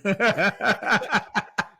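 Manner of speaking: big burst of real laughter. Real, not acted. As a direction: break into hearty laughing. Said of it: "I'm probably gonna laugh".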